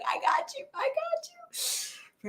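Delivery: soft, slow whispered comfort; soothing whisper. Retold or imitated. Doctor holds her sobbing patient, whispering soft comfort. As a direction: hush her gently while holding her.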